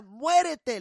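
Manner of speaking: furious mother. Retold or imitated. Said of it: "Súper enojada".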